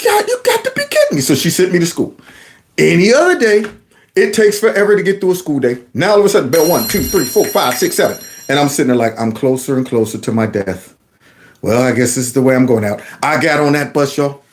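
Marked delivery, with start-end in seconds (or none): 0.0-1.2 very high, quick mock protest; exasperated disbelief. Boy told he must go to school, acted high-pitched disbelief. 9.2-13.0 mock resignation. Dreading doom at school, resigned.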